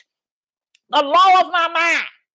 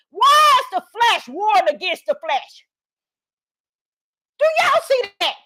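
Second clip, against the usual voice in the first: preaching shout; 6 semitones higher than usual. Acted.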